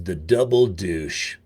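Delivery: low, slow drawn-out cowboy rumble; lightly done Sam Elliott impression. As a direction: lean back and slowly growl it like a grizzled tough guy. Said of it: "he's got this voice like this".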